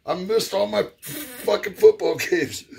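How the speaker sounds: mock a cranky old man complaining; grumpy uncle. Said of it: "he goes".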